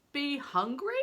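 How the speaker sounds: big stranger. A narrator's reading. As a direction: play the huge man, loud and hearty.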